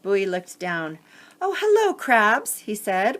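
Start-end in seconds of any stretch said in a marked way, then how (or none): 1.4-2.6 higher, brighter greeting, later redone; first take of Bowie's greeting. Acted. Reader's first try at Bowie greeting the crabs, mild lift. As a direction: read the greeting then correct it. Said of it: "let me fix that".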